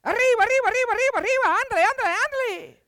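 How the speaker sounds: squeaky high cartoon mouse shouting arriba and ándale; Speedy Gonzales. Preacher doing the fast cartoon mouse for laughs, high and loud. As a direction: pitch way up and rattle it off like a racing mouse. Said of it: "That's how Speedy would go".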